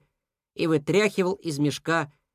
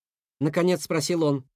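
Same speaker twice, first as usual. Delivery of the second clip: ordinary delivery, nothing marked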